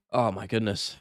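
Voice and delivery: normal talking voice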